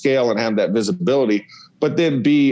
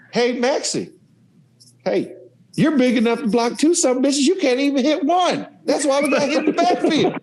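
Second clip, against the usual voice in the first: high, laughing mock rebuke, much higher; imitating Coach Spurrier; 12 semitones higher than usual. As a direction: roast the lineman like the coach did. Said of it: "he goes".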